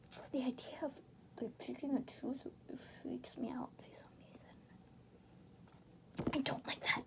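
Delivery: soft, nearly whispered disgust; hushed aside. Real, not acted. Reader creeped out by tooth picking, hushed. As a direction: murmur it, grossed out. Squeamish aside about teeth.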